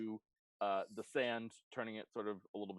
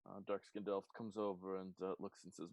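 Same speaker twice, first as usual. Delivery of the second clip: normal talking voice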